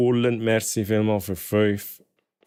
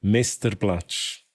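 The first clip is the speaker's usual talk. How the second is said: usual speaking voice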